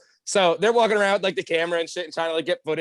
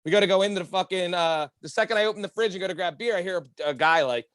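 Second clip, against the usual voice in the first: ordinary talk, nothing marked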